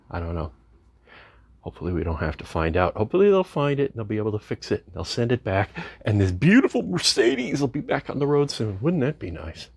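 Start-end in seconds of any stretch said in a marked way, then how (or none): none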